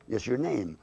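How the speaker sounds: ordinary talk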